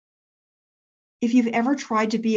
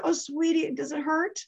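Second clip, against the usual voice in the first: higher, softer motherly coo; soothing mom; 7 semitones higher, 4 dB quieter than usual. Retold or imitated. Mom to her hurt daughter, tender sympathy. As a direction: gently ask in a tender raised voice.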